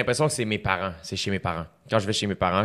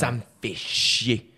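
slow, drawn-out Parisian French accent; a light Parisian French accent, dragged out; 2 semitones higher, much slower than usual. Shown as a demonstration. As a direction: say it like a fed-up French person from France. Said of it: "tu l'as vraiment dit avec un accent français".

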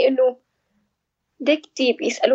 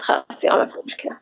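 dismissive therapist; 11 semitones lower than usual. Retold or imitated. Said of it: "قالت".

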